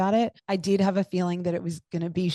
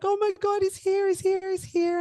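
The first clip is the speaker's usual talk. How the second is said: much higher, ecstatic, breathless repeats; reliving the shock of the baby arriving; squealing with joy at the newborn; overjoyed disbelief; 12 semitones higher than usual. Retold or imitated. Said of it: "I was just like".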